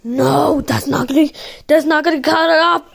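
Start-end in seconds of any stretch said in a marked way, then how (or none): none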